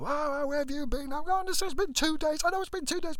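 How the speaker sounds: high, squeaky mock voices bickering; argument through the door. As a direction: squabble in a shrill falsetto. Couple arguing through the door.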